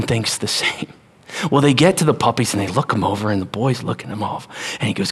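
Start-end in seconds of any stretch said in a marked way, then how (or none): none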